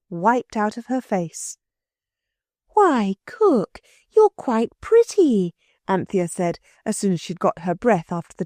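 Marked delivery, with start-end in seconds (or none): 2.7-5.5 higher, slow, wondering; amazed girl. A narrator's reading. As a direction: marvel at the change in Cook. Anthea amazed.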